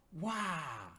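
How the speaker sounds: mock scare cry of wah; a silly ghostly wah; playful boo. Throws covers on Emily playfully.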